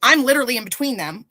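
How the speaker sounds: ordinary talk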